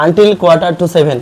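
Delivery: slow, loud English read for the class; slow classroom reading. Shown as a demonstration. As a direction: read each word out slowly and clearly. Teacher reads English text aloud.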